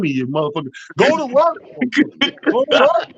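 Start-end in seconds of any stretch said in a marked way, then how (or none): 1.0-1.6 much higher, rising rallying cry; punchy sign-off chant; hype call. Real, not acted. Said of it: "show sign-off slogan". 2.5-3.1 much higher rising shout, repeated; rallying cry echoed; hype call again. Real, not acted. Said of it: "repeated sign-off slogan".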